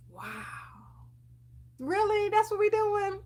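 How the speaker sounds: high, incredulous mock outrage; exasperated disbelief. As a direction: act stunned by the nerve of it. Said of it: "And I'm just like".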